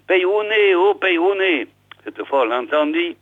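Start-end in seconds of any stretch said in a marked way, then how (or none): 0.1-1.7 mild storyteller's shout, pitched up; calling out. A narrator's reading. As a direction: call up to wake a sleeping neighbour. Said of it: "de nouveau il cria".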